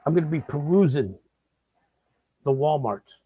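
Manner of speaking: ordinary delivery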